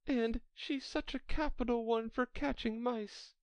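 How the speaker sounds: high-pitched Alice, fond chatter; Alice reminiscing fondly. Acted. Alice chats about her cat.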